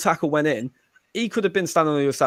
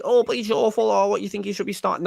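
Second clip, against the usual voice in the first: high-pitched imitation of indignant critics; mock objection; 8 semitones higher than usual. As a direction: parody a protesting fan, pitched way up. Imagined fans objecting to his praise.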